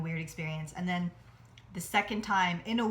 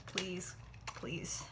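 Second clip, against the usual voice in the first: breathy whispered plea, softer; whispered begging; 9 dB quieter than usual. Real, not acted. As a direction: whisper a prayer to the pan. Begging the flan to drop.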